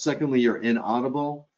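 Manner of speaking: ordinary talk, nothing marked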